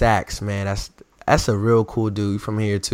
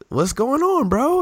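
excited fan quote; slower than usual. Retold or imitated. Quoting a fan's greeting.

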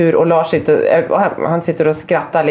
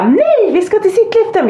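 high, drawn-out parental protest; acted-out exasperated coaxing; pleading protest; 14 semitones higher, slower than usual. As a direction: stretch out the objection, pitch way up. Parent arguing with sulking child.

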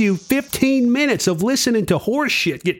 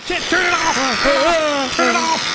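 panicked; 6 semitones higher, slower than usual. Acted. Frantic at the switch.

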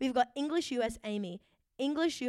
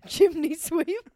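loud, drawn-out chimney-sweep voice; mock British orphan; 5 semitones higher, 8 dB louder, slower than usual. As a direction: ham it up as a British street urchin. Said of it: "like a little orphan".

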